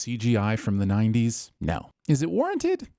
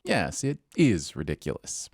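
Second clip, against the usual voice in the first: low, deadpan agreement; 8 semitones lower than usual. Real, not acted. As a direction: drop it low and dry. Sarcastic agreement.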